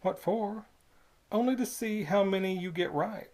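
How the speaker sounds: put-on a sly old man's cover story; cunning Squids. Old toll keeper hiding his trick from the boy, slyly. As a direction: sound innocent while scheming. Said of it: "replied the cunning Squids".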